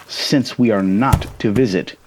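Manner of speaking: plain reading voice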